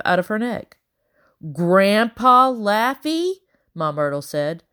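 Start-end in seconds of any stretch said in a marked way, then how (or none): 1.5-3.3 high, drawn-out shrill question. A narrator's reading. Ma Myrtle questioning a name she dreads, shrill. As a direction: screech it as a startled older woman. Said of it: "much more shrilly this time".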